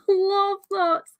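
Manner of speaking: high, slow, laughing delivery. Real, not acted. Host admitting to thoughts on intimate scenes, laughing. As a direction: let the laugh lift the words right up.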